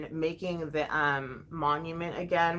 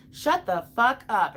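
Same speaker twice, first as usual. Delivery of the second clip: loud, higher-pitched snap; angry retort; 5 semitones higher, 5 dB louder than usual. Real, not acted. Mom telling off dismissive therapists, angry. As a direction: cut them off angrily. Snapping back at the quoted therapists.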